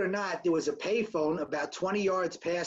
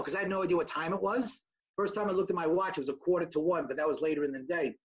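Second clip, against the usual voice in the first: normal talking voice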